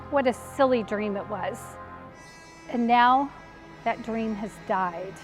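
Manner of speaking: low, hushed and defeated; soft, mournful resignation; quiet grief. Acted. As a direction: let the voice sink as hope dies. Mary mourns the dead dream.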